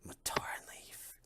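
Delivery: hushed repeat; the unseen gnome whispers the words back. Acted.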